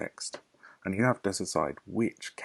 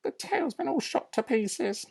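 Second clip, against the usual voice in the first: high, shrill-free bossy lady voice, much higher; Mrs Bean. Acted. Farmer's wife calling down from upstairs, bossy and high.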